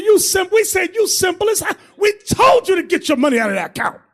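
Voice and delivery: high, loud I-told-you-so; retold exasperated telling-off; exasperated scolding. Retelling his scolding of the brother.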